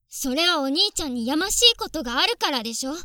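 loud, accusing shout; jealous rage. Acted. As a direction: hurl the accusation at him. Jealous sister accuses brother loudly.

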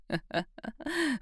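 high, sadistic cackle-like laugh; gleeful mocking laugh. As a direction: delight in his struggling. Amused by his thrashing.

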